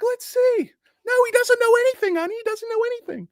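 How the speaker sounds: very high, quick falsetto; mock wife. Mock wife dismissing the streamer in a squeaky falsetto.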